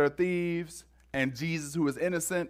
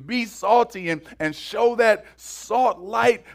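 louder and higher, driving preacher cadence; full-force preaching; 5 semitones higher, 5 dB louder than usual. Acted. Preacher urging the congregation to act today, rousing sermon voice. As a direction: build the sermon to a rousing call.

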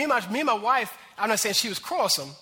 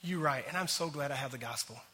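softer and lower, a grateful admission; quiet, sincere acceptance; 8 semitones lower, 10 dB quieter than usual. Retold or imitated. Pastor voicing the better answer, quiet and sincere. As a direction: say it softly, glad and humble. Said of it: "or you can go".

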